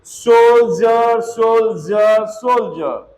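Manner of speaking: सोल्जर slowly, dge as ज; drawn-out Soldier. Shown as a demonstration. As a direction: stretch सोल्जर with a clear ज sound. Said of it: "डी का उच्चारण क्या होगा?". (सोल्जर को धीरे, ज के साथ)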